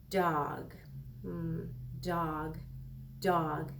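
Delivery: "Dog" said low and drawn out to hear its first sound; sounded out slowly. Shown as a demonstration. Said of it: "listen and hear if we hear that I sound".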